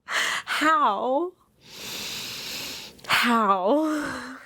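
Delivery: high, slow teary disbelief; crying-laughing in amazement; stunned. Real, not acted. Reader stunned by the chapter, teary laughing disbelief. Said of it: "a little emotional".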